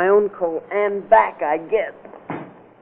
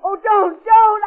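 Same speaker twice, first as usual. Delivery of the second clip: high, rising frantic plea, louder at the end; terrified pleading; 14 semitones higher, 5 dB louder, slower than usual. Acted. Clerk begging her attacker, panicked high pleading. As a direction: beg for your life as the blow comes.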